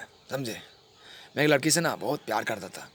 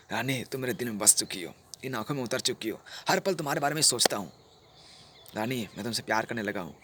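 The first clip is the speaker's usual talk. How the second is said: hushed, loving murmur; tender confession; 3 semitones lower, 6 dB quieter than usual. Acted. Lover tells Rani she is in his heart, soft and tender. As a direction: declare love softly, dropping at the end.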